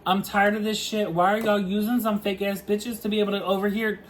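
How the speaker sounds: ordinary delivery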